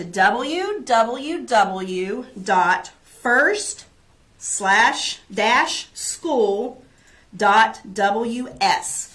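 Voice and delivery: slow, clear web address, letter by letter; slow dictation. Shown as a demonstration. Teacher reading a website to parents, slowly. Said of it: "but I will say it".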